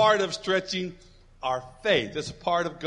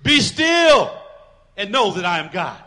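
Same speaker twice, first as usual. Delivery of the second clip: declaration with authority; 9 dB louder than usual. Shown as a demonstration. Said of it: "an authority statement made, a declaration".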